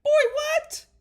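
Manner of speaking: high, loud disbelief; incredulous outburst. Real, not acted. Host reacting to Chris claiming undecided, incredulous outburst.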